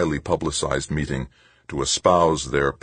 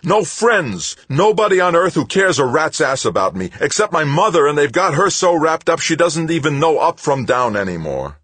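high, loud furious yelling; furious rant; 13 semitones higher, 7 dB louder than usual. Acted. Tavalera yelling at Stoner that nobody cares. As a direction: rage at him, much louder and higher.

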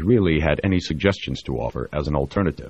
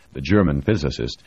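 ordinary delivery, nothing marked

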